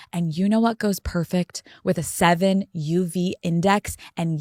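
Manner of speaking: upbeat sponsor read over music; podcast host reading a seltzer ad to fans, peppy. Acted. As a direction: sell it to the listeners, breezy and bubbly.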